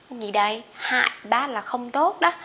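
ordinary talk, nothing marked

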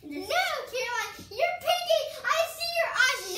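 high, loud caught-you call; accusing shout. Real, not acted. Catches sister peeking.

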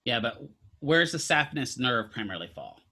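ordinary talk

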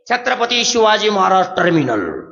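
normal talking voice

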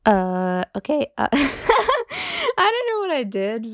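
loud, high embarrassed laughter through the words; laughing. Real, not acted. To the listeners after breaking character, laughing loudly. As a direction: laugh off the awkwardness of it all.

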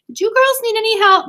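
high, loud, singsong call. A narrator's reading. Mother to girls in the kitchen, calling out. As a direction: call through to another room. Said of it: "called from the living room".

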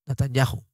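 NATANYAHU said "natan-YA-ju"; soft J sound. Shown as a demonstration. Teacher repeating Natanyahu for listeners, soft J. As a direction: lightly sound the H of "Natanyahu" as a Spanish J. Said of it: "es una J muy suave".

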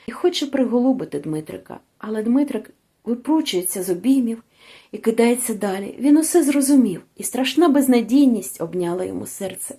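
ordinary delivery, nothing marked

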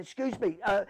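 bang on the door and call for help; retold call to the prison officer. Calling officer through cell door.

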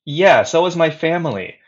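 mildly annoyed, biting it back; annoyed retort. Retold or imitated. Retorting to a veteran on the train, mildly annoyed. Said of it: "so annoyed at the time".

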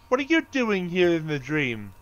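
usual speaking voice

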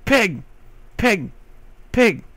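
high calls to the pig; calling out. Real, not acted. Gamer calling out to a pig, loud. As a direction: call the pig over.

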